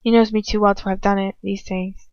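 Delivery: ordinary delivery, nothing marked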